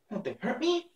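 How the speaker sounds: put-on high-pitched anxious little girl. A narrator's reading. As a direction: ask it worriedly, pitched high. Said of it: "asked the girl anxiously".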